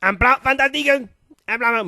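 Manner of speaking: high, loud put-on German accent in French; hammed-up Hitler German accent. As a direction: boast of the plan in a thick German accent. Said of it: "faire l'accent allemand".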